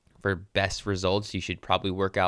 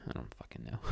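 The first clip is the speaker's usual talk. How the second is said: whispered; 9 semitones lower, 17 dB quieter than usual. Real, not acted. Gives up on the accent.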